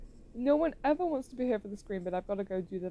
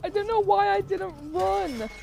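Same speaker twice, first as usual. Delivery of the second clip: mock wail; 10 semitones higher, 5 dB louder, slower than usual. Mock wail over scary game clip.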